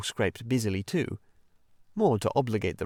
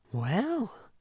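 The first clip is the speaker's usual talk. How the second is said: slow, thoughtful; 11 semitones higher than usual. Acted. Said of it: "he said at last, slowly".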